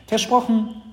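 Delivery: high, loud, firm „Versprochen“ that falls at the end; little sister promising. Acted. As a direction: confirm it eagerly in a child's voice. Sister gives her promise.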